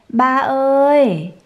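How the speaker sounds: drawn-out words, slow and clear; slow coaxing. Shown as a demonstration. Mother coaxing her baby to say mama and papa, slow. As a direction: stretch each word for the baby. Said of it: "coaxing baby to say papa".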